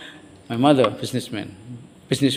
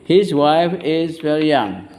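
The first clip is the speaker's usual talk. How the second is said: slow, drawn-out teacher's model sentence; repeat-after-me model; 2 semitones higher, slower than usual. Shown as a demonstration. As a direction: stretch each word for the class to repeat.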